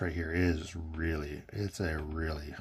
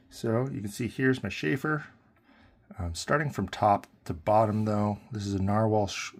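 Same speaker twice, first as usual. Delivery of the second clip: normal talking voice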